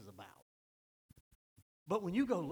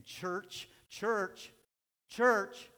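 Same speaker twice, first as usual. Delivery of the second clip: each church louder than the last; rising call; 7 semitones higher, 10 dB louder, much slower than usual. Acted. Preacher calling out to the church, building louder.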